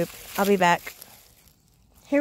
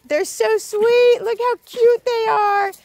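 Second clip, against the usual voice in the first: cooing; 9 semitones higher, 5 dB louder than usual. Real, not acted. Cooing at rescue dogs.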